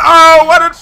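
high, loud astonished outcry; stunned gamer exclaiming; shocked shout. Real, not acted. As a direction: yell in disbelief at the replay. Shocked at on-screen collision.

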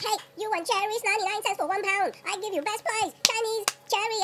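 hard-sell in a mock Chinese accent; mock Chinese vendor. Chinese supermarket vendor selling cherries, mock Chinese accent. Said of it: "Chinese guys in the supermarket".

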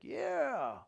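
high, loud drawn-out cheer; mock-rousing "yeah"; eager agreement. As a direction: chime in like an eager crowd. Disciples chime in with Judas.

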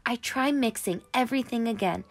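ordinary delivery

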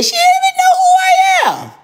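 high exasperated shout. Real, not acted. Exasperated outburst about prank target.

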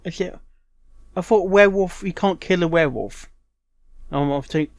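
normal talking voice

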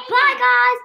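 high, loud farewell; calling goodbye. Real, not acted. Child signing off to dad's viewers, calling goodbye. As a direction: sing out a big high goodbye.

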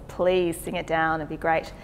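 normal talking voice

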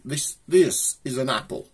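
lightly posh accent on "apple". Shown as a demonstration. Showing viewers how posh folk say it, in a light posh accent. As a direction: say "apple" with a clipped posh short a, like "ah-pple". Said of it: "to a posh person".

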